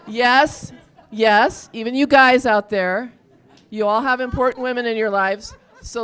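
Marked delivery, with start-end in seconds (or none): none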